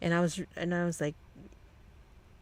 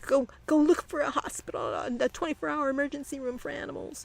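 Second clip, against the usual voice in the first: much higher, urgent mock panic; frantic order; 9 semitones higher than usual. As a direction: imitate her frantic barking of orders, pitched way up. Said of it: "all frantic".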